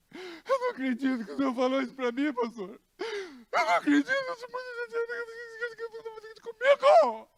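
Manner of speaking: imitating her crying, rising into a wail; mock crying. Sister hurt by the pastor's words, mock crying wail. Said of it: "Aí ela começou a chorar".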